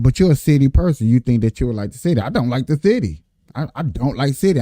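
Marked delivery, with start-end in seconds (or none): 0.0-2.2 fast boss quote. Retold or imitated. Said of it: "he's like".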